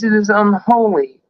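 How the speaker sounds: usual speaking voice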